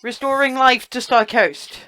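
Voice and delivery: keen and pressing, don't let it slide; excited. Real, not acted. Insists on not skipping ahead.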